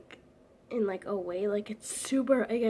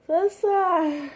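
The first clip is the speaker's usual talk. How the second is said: calling out; 7 semitones higher, 8 dB louder than usual. Real, not acted. Calling sister from another room.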